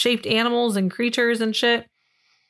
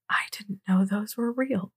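whispered; 3 semitones lower, 7 dB quieter than usual. Retold or imitated. Own astonished quote in bird story.

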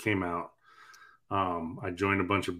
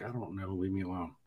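much quieter sulky mumble; 10 dB quieter than usual. Retold or imitated. To wife about his stuff, quiet sulky mumble. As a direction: mumble it away like a sulking kid. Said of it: "I'm like".

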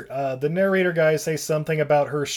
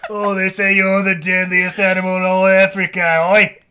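loud, put-on British tough-guy accent; Jason Statham accent; 3 semitones higher, 6 dB louder than usual. Drunk Tarzan taking on a hippo, mock British accent. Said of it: "I don't know why I have that accent".

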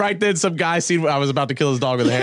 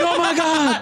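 high-pitched hammed-up fright; mock panic; slower than usual. Joking that the panther is jumping out, mock panic. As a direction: yelp it in fake terror, half laughing.

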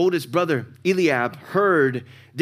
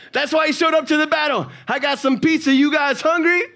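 put-on high, laughing delivery boy voice; goofy delivery boy offering pizza, laughing; 11 semitones higher, 5 dB louder than usual. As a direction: play the cheery pizza guy arriving. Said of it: "So let me say it like this".

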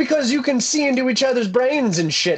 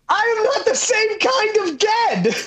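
high, slow indignant protest; 13 semitones higher than usual. Acted. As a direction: insist slowly, much higher. In-character argument about undeath.

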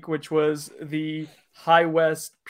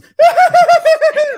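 loud mock laugh; 15 dB louder than usual. Retold friend's excited reaction.

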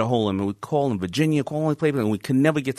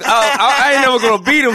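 much higher, louder outburst of defeat; frustrated shout; 12 semitones higher, 6 dB louder than usual. Retold or imitated. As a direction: yell it out in frustration. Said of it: "I was like".

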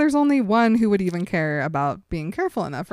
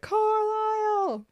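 calling out; 13 semitones higher, 5 dB quieter, much slower than usual. Retold or imitated. Alice calling for Carlisle.

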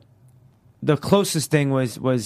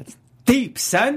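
mock street bro voice; 10 semitones higher, slower than usual. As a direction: play it like a hype bro.

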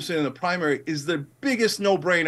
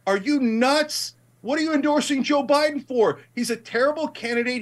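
pitched up, incredulous; exasperated outburst; 7 semitones higher than usual. Real, not acted. Host ranting at progressives in Congress, exasperated. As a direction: demand an answer, exasperated.

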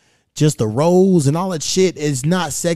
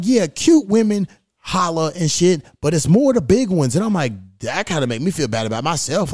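normal talking voice

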